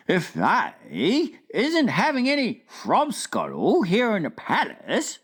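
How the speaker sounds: lightly voiced friendly giant, slow wondering question; BFG voice. Giant asking the Queen at breakfast, wondering. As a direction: puzzle it out like a big gentle giant.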